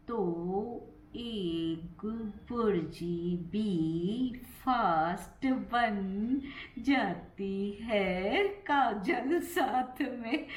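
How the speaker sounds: syllable-by-syllable, heavily stretched delivery; very slow. Shown as a demonstration. Vlogger practising slow speech about cooking, very slow talking. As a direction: draw each word right out, laughter creeping in at the end. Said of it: "ऐसे नहीं बोल पाऊंगी मैं".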